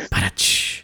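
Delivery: vocal imitation of a drum sting; mock rimshot. Host capping their own dirty joke, mock rimshot. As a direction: toss off a low "ba-da-ch" like a rimshot.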